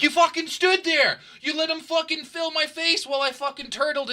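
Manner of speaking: loud and high, yelling at a friend who stood by; furious retold yell at a friend; retold angry rant. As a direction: tear into him for not helping.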